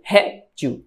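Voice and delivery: "Réptil" split, strong RÉP and light til; first-syllable stress. Shown as a demonstration. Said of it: "réptil vai ser uma paroxítona".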